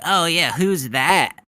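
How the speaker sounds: drawn-out fake ignorance; mock innocence. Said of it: "You're just like".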